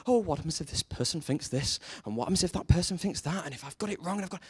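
hushed, worried inner voice; mock fretting. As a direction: fret softly to yourself, lightly acted. Acted anxious inner thoughts.

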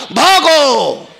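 high, long-drawn call to flee; mock retreat cry. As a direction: stretch out a comic battlefield yell. Acted army fleeing after sudden retreat. (पळ काढण्याची लांबलचक आरोळी)

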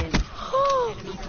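sharp high gasp; shocked gasp. Real, not acted. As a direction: gasp in disbelief. Sees the hacker kill.

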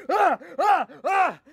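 short high mock angry cries; hammed-up rage yells. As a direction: let out comic furious yells. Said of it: "Remo, calm down".

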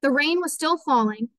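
ordinary delivery

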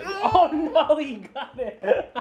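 can't stop laughing as the dog gets it. Real, not acted. Dog grabs something, dad laughing.

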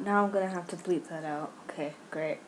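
ordinary delivery

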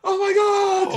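high, loud, hammed-up shriek; mock horrified scream. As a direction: play the shocked horror-film victim. Mock horror at inside-house twist.

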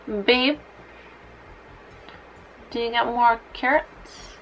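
ordinary delivery

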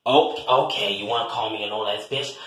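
ordinary talk

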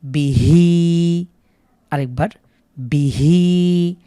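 "বিহি" with the হি held long and slow; drawn-out second syllable. Shown as a demonstration. Teacher contrasts the short বি with the long হি. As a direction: keep বি short, stretch the হি. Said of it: "আবার হিতে টান হবে".

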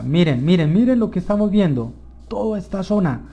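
high, loud burst of real wonder; excited. Real, not acted. Player showing viewers a beautiful landscape, excited. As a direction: urge everyone to look, thrilled. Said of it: "me emociono mucho".

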